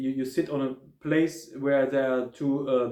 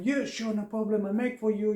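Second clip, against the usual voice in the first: higher, easygoing yes; obliging night manager; 6 semitones higher than usual. Retold or imitated. Night manager answering a guest's coffee request, light and higher. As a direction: happily agree to fetch the coffee.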